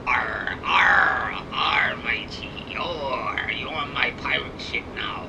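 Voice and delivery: lightly hammed-up pirate captain; old pirate man. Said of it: "I sound like an old pirate man".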